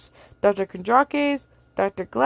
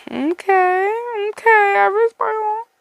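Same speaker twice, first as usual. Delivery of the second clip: stunned reaction; 10 semitones higher, slower than usual. Real, not acted. Reader reacts after reading.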